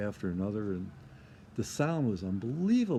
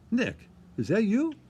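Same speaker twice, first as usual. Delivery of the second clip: much higher, louder delighted "Nick, is that you?"; surprised recognition; 12 semitones higher, 5 dB louder than usual. Retold or imitated. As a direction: call out in glad surprise at seeing an old friend. Old friend recognizes him backstage.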